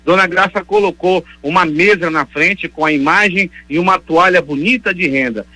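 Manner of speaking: ordinary talk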